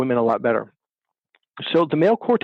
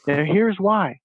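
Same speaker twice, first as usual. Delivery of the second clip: ordinary talk, nothing marked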